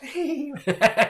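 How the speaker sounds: high, helpless giggle; burst of laughter. Real, not acted. Remembering the receptionists' reaction, giggling. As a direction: let the laugh break out.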